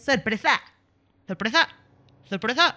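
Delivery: loud, repeated exclamation; surprise announcement. Pretend. Host springs the surprise on her co-host, loud announcing call.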